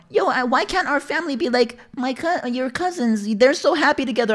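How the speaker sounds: high, put-on complaining voice; mock mom. Son mimics mom envying the cousins, high mocking plea. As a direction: mimic a mother's plea in falsetto. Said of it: "I'm talking about".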